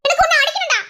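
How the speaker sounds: very high, loud angry outburst; exasperated yell. Acted. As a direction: yell at a partner in fury. Quarrel, she wants to hit him.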